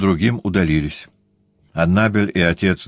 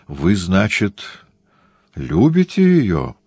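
ordinary delivery, nothing marked

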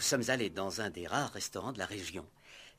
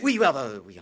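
much louder, tumbling out; flustered loud agreement; flustered backpedal; 4 semitones higher, 13 dB louder, faster than usual. Acted. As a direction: hurry to take it back.

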